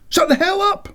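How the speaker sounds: very high angry shout; angry yell. Acted. McX yelling back at Siobhan, high angry shout.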